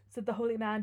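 ordinary delivery

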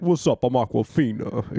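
lightly imitated catchphrase, dropping on the name; Aquafina. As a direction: greet in a mildly put-on voice. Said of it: "It wasn't that one".

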